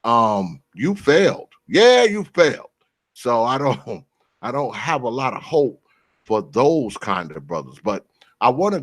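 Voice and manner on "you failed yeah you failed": mocking. Real, not acted. Mocking the basement dweller.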